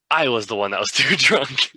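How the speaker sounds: words breaking into laughter; laughing through words. Retold or imitated. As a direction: laugh out the confession. Realizing he was tricked.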